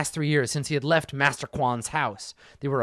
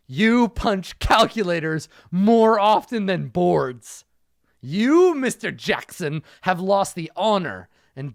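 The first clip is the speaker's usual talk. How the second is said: slow stern rebuke; 3 semitones higher, 4 dB louder, slower than usual. Acted. Master scolding in read story.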